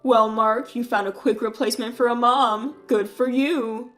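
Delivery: drip sarcasm on the good for you; mocking brother. Brother taunts the groom about being a single dad, sneering. Keith belittles Mark.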